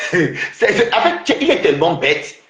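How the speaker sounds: words bubbling through laughter; amused, laughing put-down; laughing scorn. Real, not acted. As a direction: talk through a laugh at the rival. Laughs at how stupid rival is.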